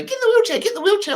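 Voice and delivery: high, insistent mock urging; airport staff urging. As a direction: push the wheelchair on him in a high voice. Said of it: "he's trying to say to me".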